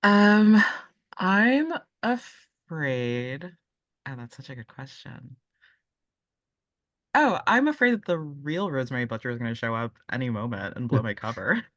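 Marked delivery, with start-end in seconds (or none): none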